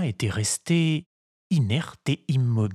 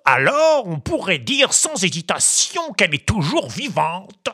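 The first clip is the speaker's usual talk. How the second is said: high, solemn pompous doctor voice; solemn crow; 10 semitones higher, 5 dB louder than usual. A narrator's reading. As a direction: declare it gravely like a learned crow. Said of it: "déclara solennellement". (voix de corbeau solennel)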